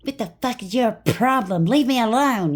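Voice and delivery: high, loud furious yell at her in a store; mimicking an ex shouting in a shop; retold screaming fit. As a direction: scream it like a man losing it in public. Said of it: "he would scream it out loud".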